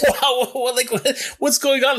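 high, flustered disbelief; retold amazed exclamation; stunned surprise. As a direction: sputter like you can't take it in. Sees church full of jeans.

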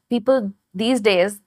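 ordinary talk, nothing marked

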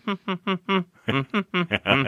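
high, soft humming along to music; Luigi humming. Retold or imitated. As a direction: hum a little tune, high and quiet. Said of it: "he's humming along with the music".

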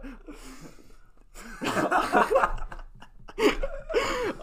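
long squealing laugh; high laughter. Real, not acted. Laughing at own impression.

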